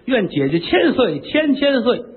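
high, drawn-out mincing court lady voice; court lady greeting. Acted. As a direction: sing out the greeting like a dainty concubine. 妃子拜见，尖声学女声.

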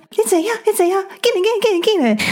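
high, eager granny calling to her friend; imitating a granny. A granny calling her friend over to a seat, high and eager. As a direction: squeal like a granny saving a seat.

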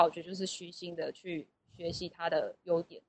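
normal talking voice

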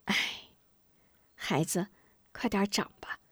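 soft, resigned motherly voice; sigh, then speak softly in defeat; helpless sigh. Acted. Mother sighs to her child, soft helpless resignation. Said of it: "无能为力地叹了口气".

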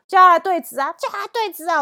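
high, very loud yell; fierce shout. Retold or imitated. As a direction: scream the challenge at her. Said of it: "我就很凶".

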